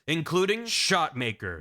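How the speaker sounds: usual speaking voice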